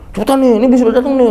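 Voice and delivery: high, loud, fast rushing; hurried fluster. Retold or imitated. Flustered guide pushing pilgrims to hurry up, high and fast. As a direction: rush them in a panic.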